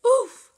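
very high loud oof; Roblox oof. Acted. Character hurt.

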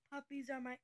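ordinary delivery

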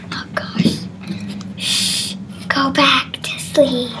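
soft hushed whisper; whispering over a crib. Acted. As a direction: whisper so the baby stays asleep. Whispering not to wake anyone.

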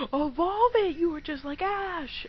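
high, loud mock outrage; exasperated outburst. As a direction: cry out high and loud at the opponent. Mock outrage at opponent's Pokémon.

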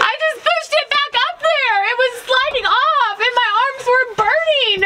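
high strained voice; strained, upset. Real, not acted. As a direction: complain breathlessly after the effort. After straining to hold roof.

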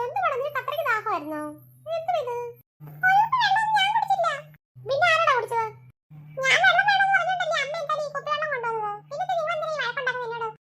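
put-on high boy voice denying and complaining; a kid protesting he's blamed unfairly; little boy defending himself to his mother; indignant little boy. Boy denies drinking.

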